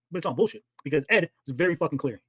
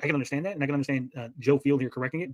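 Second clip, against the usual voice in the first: normal talking voice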